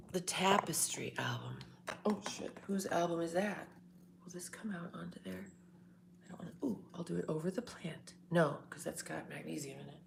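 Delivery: quick hushed whisper, mostly unvoiced. Real, not acted. Whispering to herself while moving things around. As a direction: keep it to a fast whisper.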